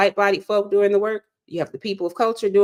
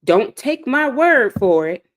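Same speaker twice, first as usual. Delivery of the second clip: slow, louder pastor's line leaning on word; preacher's refrain; 4 semitones higher, 5 dB louder, slower than usual. Retold or imitated. Quoting her pastor after a sermon, slow emphatic preaching. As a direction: echo the pastor's pulpit warning, slow and firm. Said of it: "He would always say after a sermon".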